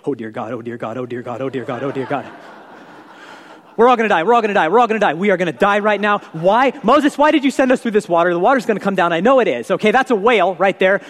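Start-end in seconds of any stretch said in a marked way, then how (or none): 0.1-2.3 low, soft, fearful repeated muttered prayer; terrified praying. Retold or imitated. Frightened Israelite crossing the sea, low panicked praying. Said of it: "We're walking through like this". 3.8-9.7 panicking. Retold or imitated. Scared Israelite complaining to Moses, mock panic. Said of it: "they're just freaking out".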